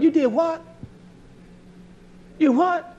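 high incredulous "you did what?"; mock disbelief. Mocking a snitch to the congregation, incredulous and high. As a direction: act shocked that anyone would do that.

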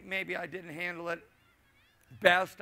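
ordinary delivery

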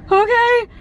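high, tight nervous okay. Retold or imitated. Patient answering the surgeon, high and nervous. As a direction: squeak out a scared yes. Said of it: "I was like".

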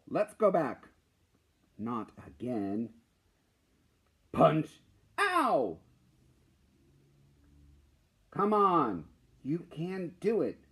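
loud, lively storybook reading with sound effects; animated comic read-aloud. Acted. Teacher reading a student's comic to the class, animated. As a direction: bring a kid's comic to life.

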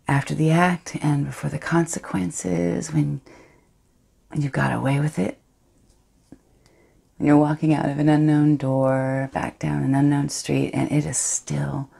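Astrid, guilty yet thrilled, hushed and intimate; a woman savouring her secret betrayal; defiant confessional monologue. Acted.

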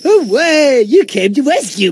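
high excited hooray; cheering. Acted. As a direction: squeal with joy. Cheering rescue.

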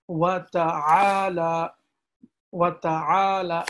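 "ta'ala" pressed from the throat, slow; throat ayn. Shown as a demonstration. Teacher repeating the throat ayn for students, slow. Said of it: "from the throat".

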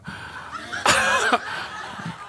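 high airless giggle; breathless laugh. Real, not acted. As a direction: laugh helplessly in silence. Laughs at wife's mistake.

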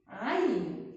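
normal talking voice

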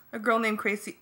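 plain reading voice

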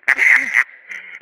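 high cackling laugh; high cackle. Acted. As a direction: cackle in his face. Captain laughs.

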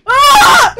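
high, piercing wail of frustration; full-volume scream of despair; anguished scream. Real, not acted. Gamer wailing as progress is lost, anguished scream.

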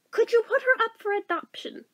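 high, mock-innocent child voice; bargaining kid. Child asking mom about adoption, high kid voice.

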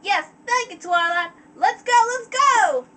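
high, excited little-filly cheer; thrilled filly. Acted. Filly thrilled at being allowed along.

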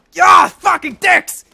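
bellowed curse; furious shout. Real, not acted. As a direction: roar at the game. Failed grab, outburst.

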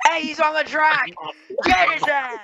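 high, loud shout of alarm; urgent shout. Real, not acted. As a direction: yell it across to the other racers. Alarm at car on track.

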